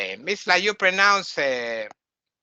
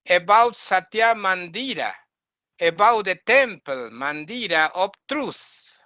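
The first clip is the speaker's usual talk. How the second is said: usual speaking voice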